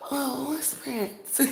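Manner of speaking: high, slow, gushing fan voice; mock starstruck fan. Mocking a starstruck fan meeting [name], high and slow. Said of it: "I'm not about to".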